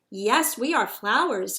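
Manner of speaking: plain reading voice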